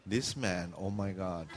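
low, soft aside to oneself; muttered inner thought. Retold or imitated. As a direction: mimic a quiet exasperated thought. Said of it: "you say that in your head".

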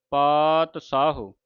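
ਪਾਤ ਸਾਹੋ drawn out slowly, with a plain s; correct pronunciation demo. Shown as a demonstration. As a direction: slowly separate ਪਾਤ ਸਾਹੋ, sounding "saa-ho". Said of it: "ਸੱਸੇ ਕੰਨਾ ਹਾਏ ਔਂਕੜ".

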